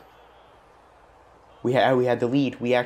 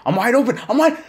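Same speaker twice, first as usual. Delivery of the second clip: much higher and louder yell; desperate shout; 13 semitones higher, 8 dB louder, faster than usual. Real, not acted. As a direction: scream for the ball. Last chance, yelling.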